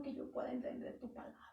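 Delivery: hushed, private petition to God; whispered prayer. Retold or imitated. Preacher voicing the psalmist's prayer, whispered. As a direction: whisper the plea, almost unvoiced. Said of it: "Le estaba diciendo".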